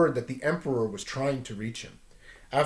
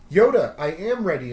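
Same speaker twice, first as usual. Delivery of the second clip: insisting; 4 semitones higher, 7 dB louder than usual. Acted. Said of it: "insisted Luke".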